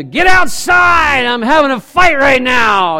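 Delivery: loud, high parent shout, drawn out; yelling at kids. Retold or imitated. Parent yelling at the kids to get outside, mock shout. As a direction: bark the kids outside mid-fight.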